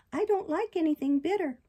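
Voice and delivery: high, loud puppet complaint; balky puppet. Acted. Puppet balking at bitter medicine, petulant.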